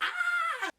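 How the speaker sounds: very high, loud sustained shriek; mock kids' scream. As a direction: scream high like startled children. Imitates kids screaming.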